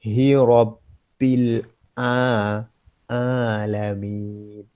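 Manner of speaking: slow model recitation, drawn out. Shown as a demonstration. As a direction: recite slowly for the student to copy. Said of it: "Pelan-pelan". (bacaan perlahan sebagai contoh)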